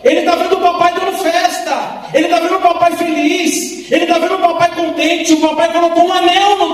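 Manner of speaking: preaching. Acted. Pastor preaching the parable to the congregation, fervent. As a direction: preach it with rising fervour.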